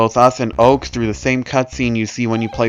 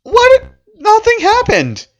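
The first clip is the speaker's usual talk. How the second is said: much higher, loud disbelieving what; real stunned outburst at a letdown; incredulous shock; 5 dB louder than usual. Real, not acted. Anticlimax of the creepypasta ending.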